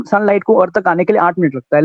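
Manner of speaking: ordinary talk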